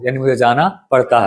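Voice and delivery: ordinary talk, nothing marked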